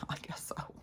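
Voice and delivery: breathless whisper with a laugh; whispered aside. Real, not acted. After mocking herself as a stupid parent, a laughing whisper. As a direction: concede it in a whisper.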